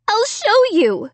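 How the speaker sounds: high, slow desperate cry. A narrator's reading. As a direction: insist desperately. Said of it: "I cried".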